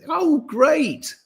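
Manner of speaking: high mocking "oh great"; sarcastic cheer. Real, not acted. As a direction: scoff by sounding thrilled. Sarcasm at the demand.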